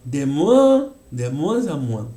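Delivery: "moins" with a nasal vowel, mwan; French moins. Shown as a demonstration. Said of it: "cuando hacemos moins". ("moins" con vocal nasal francesa)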